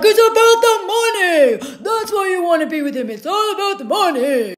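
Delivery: mocking Noah. Mocking Noah accusing her over money. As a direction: sneer it like a smug know-it-all. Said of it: "He's like".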